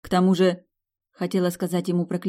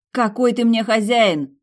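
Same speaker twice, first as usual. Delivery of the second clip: higher, louder, slow indignant challenge; indignant flare-up; 6 semitones higher, 6 dB louder, slower than usual. Acted. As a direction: flare up against his claim to own her. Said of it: "вскинулась я". (служанка возмущённо вскидывается на хозяина, высоко и громко)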